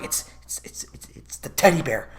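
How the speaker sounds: unvoiced whisper; whispering it's the teddy bear; mock whisper. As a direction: hush it like a secret. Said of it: "And they're like".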